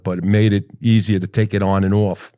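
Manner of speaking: ordinary talk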